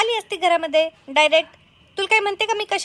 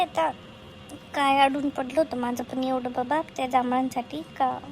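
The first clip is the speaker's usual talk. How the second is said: usual speaking voice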